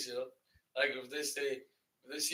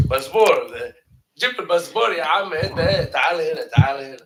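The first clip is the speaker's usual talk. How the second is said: loud, demanding Egyptian official; Egyptian airport officer; 3 semitones higher, 10 dB louder, faster than usual. Acted. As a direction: order a traveler over, loudly. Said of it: "go to the airport is like".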